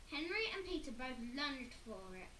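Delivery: usual speaking voice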